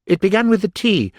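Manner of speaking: high, anxious Hatter; nervous Hatter voice. Acted. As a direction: plead nervously. The Hatter pleading.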